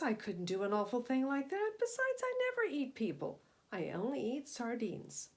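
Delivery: high, loud, indignant protest; gentle serpent protesting. Acted. Cyrus answering the shark, hammed-up indignant protest. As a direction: insist you'd never hurt anyone. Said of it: "said Cyrus".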